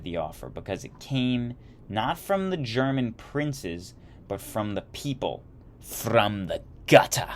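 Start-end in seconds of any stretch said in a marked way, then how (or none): 5.8-7.3 mock contempt. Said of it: "as he described it".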